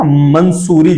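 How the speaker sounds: मंसूरी with a clear n, "man-soo-ree"; first syllable closed with n in मंसूरी; common mispronunciation. Retold or imitated. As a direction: imitate people saying मंसूरी with an extra n. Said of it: "लोग कहने लगते हैं ना".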